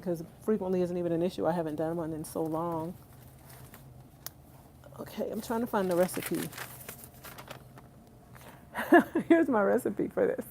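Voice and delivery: normal talking voice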